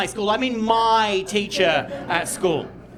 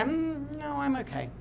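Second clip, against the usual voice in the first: reluctant refusal; 4 semitones higher, 7 dB quieter, slower than usual. Retold or imitated. Son declines dad's hunting invitation.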